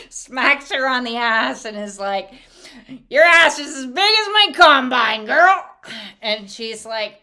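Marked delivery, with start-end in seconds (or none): 3.1-5.6 very loud, raised farmer shout; brash hammed-up holler; hollered crude teasing. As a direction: belt it out at the wife, climbing higher. Husband hollers crude joke at wife.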